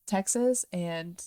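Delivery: normal talking voice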